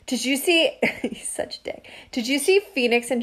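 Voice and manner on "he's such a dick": a quick whispered jab, voice gone; whispered aside. Real, not acted. Joking about Greg, a whispered jab. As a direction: breathe the insult as a joke.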